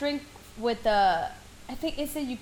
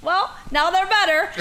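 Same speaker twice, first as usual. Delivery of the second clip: laughing retort; 7 semitones higher, 4 dB louder than usual. Retold or imitated. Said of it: "And I was like".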